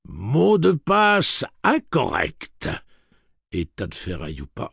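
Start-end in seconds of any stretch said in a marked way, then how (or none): 0.1-2.7 loud, slow, high robot verdict; furious glee. A narrator's reading. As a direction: draw it out with savage robotic pleasure. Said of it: "le ton de plaisir furieux".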